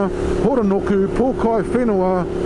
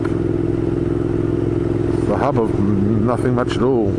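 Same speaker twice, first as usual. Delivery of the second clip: deadpan mock; 7 semitones lower, much slower than usual. Real, not acted. Deflating mock of slogan.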